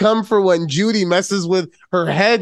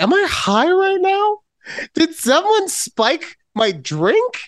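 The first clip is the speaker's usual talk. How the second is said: incredulous; 11 semitones higher than usual. Retold or imitated. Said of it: "I was like".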